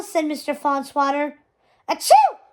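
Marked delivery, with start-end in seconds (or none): 1.9-2.4 big high sneeze bursting out; loud sneeze. Acted. Anita sneezing through the family photo, loud and high. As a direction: let a huge sneeze explode. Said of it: "Anita said, ruining the shot".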